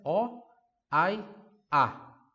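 "Ho", "hai", "ha" each punched loud and apart; stressed verb forms. Shown as a demonstration. Teacher contrasting verb forms, each one punched. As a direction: hit each of "Ho", "hai", "ha" hard. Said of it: "Viu a diferença?".

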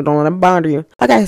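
normal talking voice

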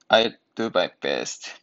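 ordinary talk, nothing marked

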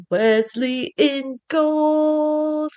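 drawn out, very slow; very slow reveal. Pretend. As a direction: stretch it out like a grand reveal.

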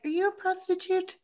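very high, tentative mock question; retold in a high, incredulous little voice; awkward question. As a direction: squeak it out cautiously, imitating an awkward man. Voices man's awkward question to girl.

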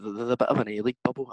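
ordinary talk

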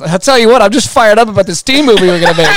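mock-excited friend spilling the news; excited blurting. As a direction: blurt it out with hammed-up enthusiasm. Impersonates Scott blurting the secret.

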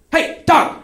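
high-pitched call after a runaway; acted urgent holler. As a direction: yell to stop a fleeing animal. Calls after fleeing dog.

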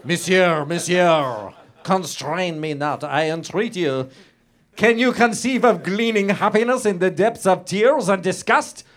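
French reader with a German-sounding reading voice. Acted. Justine entreats Dubourg, read in a German-sounding accent. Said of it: "sounds now that I'm German".

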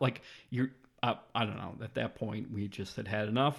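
usual speaking voice

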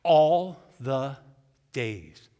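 spelling it out, then ALL stressed. Shown as a demonstration. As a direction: hit ALL hard, then let "days" fall. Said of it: "A L L".

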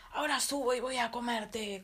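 troll threatening to eat the little goat, loud; menacing troll. Acted. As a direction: bellow the threat like a hungry troll. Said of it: "contestó el ogro".

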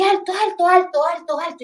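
insistent called alto; calling stop. Retold or imitated. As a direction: wave down the traffic with your voice. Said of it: "yo así".

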